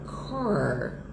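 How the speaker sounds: car with a heavy rolled R, caipira style; exaggerated American R; rural American R. Shown as a demonstration.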